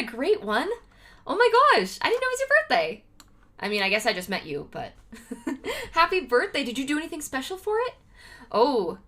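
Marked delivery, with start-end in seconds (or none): 1.3-3.0 excited. Real, not acted. Learns viewer's birthday.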